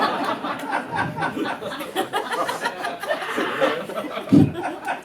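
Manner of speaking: breathy high silent laugh; whispered high laugh. Real, not acted. Laughs at own joke.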